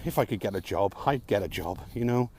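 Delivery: ordinary talk, nothing marked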